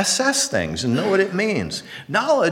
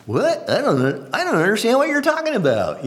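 mock confusion pitched much higher; feigned innocence; 9 semitones higher than usual. Magician pretending to know nothing of the trick, high innocent voice. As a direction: play dumb about the trick. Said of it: "Or we'd pretend".

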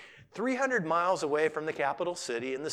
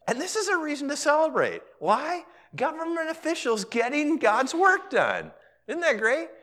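much higher, louder and quicker, rallying; excited preaching; 11 semitones higher, 5 dB louder than usual. Real, not acted. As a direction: fire the congregation up. Preacher urging celebration.

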